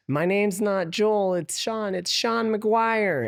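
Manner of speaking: high, drawn-out whiny taunt; mocking mimic voice. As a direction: mimic him in a stretched, high-pitched voice. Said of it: "he yells it out in, like, a voice like that".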